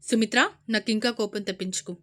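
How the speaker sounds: loud quick angry warning; angry shout. Acted. Brother shouting at sister, angry. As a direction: snap it at her. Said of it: "అంటూ అరిచి".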